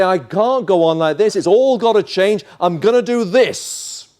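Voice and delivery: loud, high, emphatic outburst; desperate resolve. Acted. Preacher voices the pushing voice in your head, desperate and loud. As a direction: throw it out as a defiant decision at full volume. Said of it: "To say".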